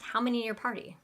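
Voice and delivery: quick, run-together host question; really fast. Shown as a demonstration. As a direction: rattle off the question like busy restaurant staff. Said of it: "they might say it really fast".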